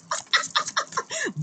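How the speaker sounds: high giggle at a slip; embarrassed laugh. Real, not acted. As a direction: laugh off the mistake. Laughs at own card mix-up.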